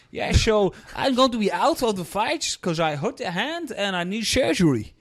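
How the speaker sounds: put-on Dutch accent with slushy s sounds. Dutch fighter announcing an injury, mock Dutch accent. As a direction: imitate a Dutch fighter speaking English. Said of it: "do my Dutch accent".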